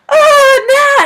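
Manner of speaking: high, loud mock wail; hammed-up high whine; imitated friend's wail. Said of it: "like, her usual thing".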